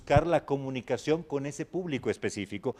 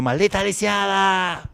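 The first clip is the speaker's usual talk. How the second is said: high, loud, drawn-out telenovela wail; hammed-up tearful shriek; crying scream; 8 semitones higher, 6 dB louder, slower than usual. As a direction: scream it slowly like a melodrama villainess. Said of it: "llorando, gritando, diciendo".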